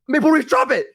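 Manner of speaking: high-pitched frantic order to a dog; acted panicked command. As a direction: order the dog urgently, in alarm. Alarmed command to dog, retold.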